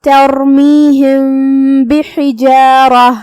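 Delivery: ترمیہم بحجارہ slow, the م half hidden and hummed before ب; ترمیہم بحجارہ with a nasal, half-hidden meem. Shown as a demonstration. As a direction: hum the م of ترمیہم gently into بحجارہ. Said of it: "میم کو تھوڑا سا چھپاتے ہوئے لیکن غنہ کے ساتھ".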